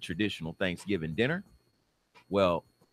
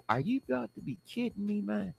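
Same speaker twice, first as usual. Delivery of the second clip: high, softer squeak of disbelief; incredulous protest; 13 semitones higher, 5 dB quieter than usual. Acted. Defendant answering the judge's ultimatum, high squeaky disbelief.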